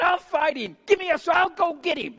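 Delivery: high-pitched, forceful volunteering; eager challenge. Acted. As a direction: jump in eagerly to take him on. David volunteers to fight the giant.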